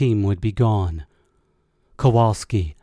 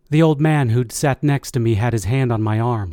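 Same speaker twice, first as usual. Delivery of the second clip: usual speaking voice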